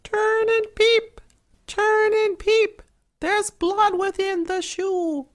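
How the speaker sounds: very high, piping bird voice; pigeons crying. A narrator's reading. Pigeons on the hazel tree warn the prince, high piping call. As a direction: chirp it out like a little pigeon.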